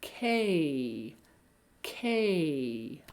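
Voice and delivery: long 'K' gliding down in pitch; stressed, stretched 'K'; small pitch change. Shown as a demonstration. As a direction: draw out 'K' with a falling pitch slide. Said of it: "So it'd be like".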